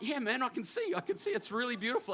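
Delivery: high, gushing retold reassurance; earnest admiration. Storyteller answers Ruben showing his gold teeth, high and admiring. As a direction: act out being moved and impressed, voice lifted high.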